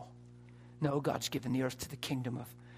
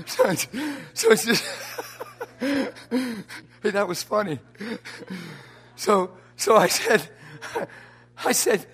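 high, loud words breaking up in laughter; real helpless laughter; 7 semitones higher, 9 dB louder than usual. Real, not acted. Preacher cracking up while retelling the miracle, real helpless laughter. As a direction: laugh so hard the sentence keeps restarting.

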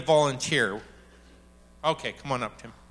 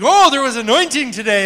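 mock pastor; 8 semitones higher, 9 dB louder than usual. Mocking pastor's reaction.

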